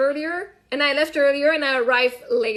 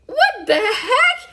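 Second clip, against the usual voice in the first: slow, much higher mock outrage; exasperated disbelief; 8 semitones higher, 4 dB louder, much slower than usual. Parent retells seeing the GPS travel time, exasperated disbelief. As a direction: draw it out in incredulous, laughing protest.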